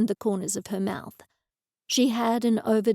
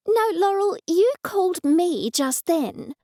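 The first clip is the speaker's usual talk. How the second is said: high, pushy exasperated mum; 8 semitones higher, 3 dB louder than usual. Acted. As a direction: insist on the phone, fed up. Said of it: "Her tone was exasperated".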